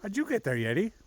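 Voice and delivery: high, sing-song call to a dog; pet talk. Real, not acted. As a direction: coo the dog's name. Calling to the dog.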